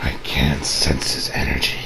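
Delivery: very low, quiet, straining voice; low strained Goku. Retold or imitated. Goku straining low while meditating for energy. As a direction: grunt it out low as if straining to sense. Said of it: "He's like".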